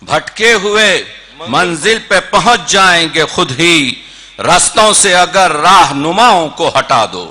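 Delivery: projected, measured mushaira recital; Urdu poetry recitation. Acted. Poet presents an old couplet to a mushaira audience, declaimed. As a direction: declaim the couplet with ringing emphasis.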